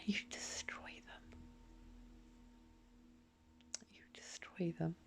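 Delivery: a real whisper, almost soundless; hushed whisper. Real, not acted. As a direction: breathe the words out, cold and grim. Accusation dropped to whisper.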